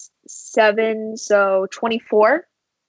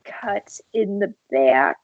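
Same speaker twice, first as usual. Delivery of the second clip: ordinary talk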